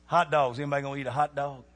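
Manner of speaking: ordinary delivery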